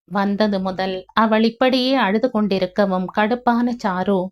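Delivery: usual speaking voice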